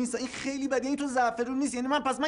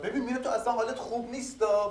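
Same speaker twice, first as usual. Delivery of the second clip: ordinary talk, nothing marked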